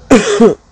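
harsh sudden coughing fit; loud cough. Real, not acted. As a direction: cough hard. Coughing after cigarette.

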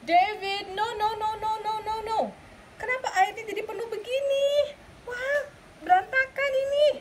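high, fussing mother voice; mother scolding her son over the flooded bath. Acted. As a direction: scold him in a high mom voice. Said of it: "Mami said".

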